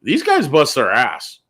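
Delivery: high, indignant mock complaint, slowed down; imitating outraged coworker. Detail guys complain about their pay, high and indignant. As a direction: act out the detail guys' outrage, pitched well up. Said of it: "And they go".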